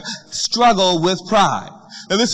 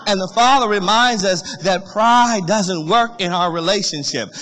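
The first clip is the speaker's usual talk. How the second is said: usual speaking voice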